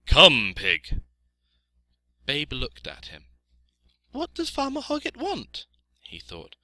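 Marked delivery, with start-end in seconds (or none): 0.1-1.0 loud farmer's call to an animal. A narrator's reading. Farmer calls the pig. 4.1-5.7 put-on high-pitched young pig voice; little piglet, puzzled. A narrator's reading.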